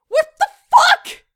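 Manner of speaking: high, loud mock-furious yell; exasperated yell. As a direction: yell it in comic disbelief. Mock outraged rant.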